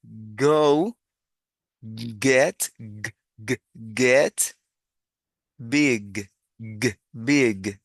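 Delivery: "Go, get, big" word by word with firm G; articulated G sound. Shown as a demonstration. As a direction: voice the final G in "big" as a released "guh". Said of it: "con la misma articulación".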